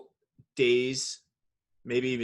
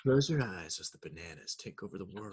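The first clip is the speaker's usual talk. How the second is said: mock sinister meditation; 5 semitones lower, 5 dB quieter than usual. Joking creepy calm app.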